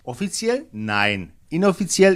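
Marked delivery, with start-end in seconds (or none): 1.5-2.1 "Inoffiziell" lifted higher and louder; stressed inoffiziell. Real, not acted. Correspondent underlining to the host that it is unofficial, stressed "Inoffiziell". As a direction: punch "Inoffiziell" with raised pitch and volume. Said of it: "ich betone".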